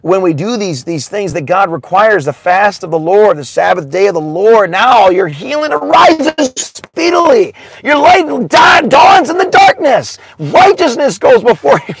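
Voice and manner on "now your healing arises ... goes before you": rising to a high, loud preacher's shout; preaching at full force. Acted. Preacher proclaiming healing to the congregation, full force. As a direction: lift the promise up until it rings out.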